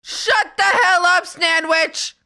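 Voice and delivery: loud, slow shout; mock angry yell. Real, not acted. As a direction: yell at a chatter jokingly. Yelling at a chatter.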